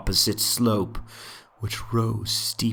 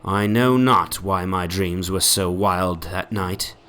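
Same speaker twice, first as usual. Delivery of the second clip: usual speaking voice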